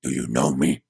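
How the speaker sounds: low-pitched, grunting male character; grunted question. A narrator's reading. Gabriel pins the woman to a wall, low grunted question. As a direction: grunt it out deep. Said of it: "He grunts".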